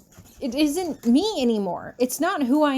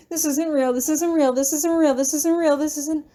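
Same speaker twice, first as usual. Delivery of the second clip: panicked repetition; 5 semitones higher than usual. Acted. Panicked repeated chant.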